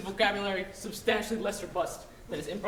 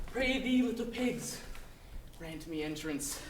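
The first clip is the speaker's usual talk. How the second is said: sinister wolf; 2 semitones lower, 5 dB quieter than usual. Acted. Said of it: "sinister hiss of the wolf".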